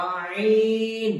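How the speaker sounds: আইন drawn out long from the middle of the throat; throat letter demo. Shown as a demonstration. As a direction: hold আইন slowly, squeezed from the throat. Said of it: "কণ্ঠনালীর মধ্যস্থল থেকে উচ্চারিত হবে".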